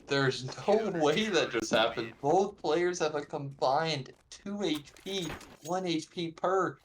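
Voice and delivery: stunned. Real, not acted. Caster reacts to clutch.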